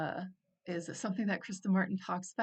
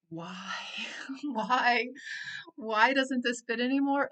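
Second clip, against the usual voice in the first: louder, higher, baffled why; exasperated why; 4 semitones higher, 4 dB louder than usual. Retold or imitated. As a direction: throw the question up in mock disbelief. Asking herself why old habits fail.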